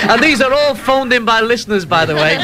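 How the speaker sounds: giggling through the words; talking through laughter; laughing. Real, not acted. Laughing after listener jokes.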